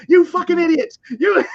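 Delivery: high, loud mock rage; going off. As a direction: blow up like a ranting news host. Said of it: "she just starts going off like".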